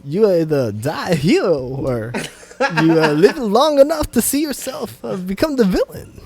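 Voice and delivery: halting, lilting star impression rising high; odd pauses, voice swinging up mid-phrase; Christopher Walken. Friend taking his turn at accents, halting Walken voice. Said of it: "Christopher Walken?".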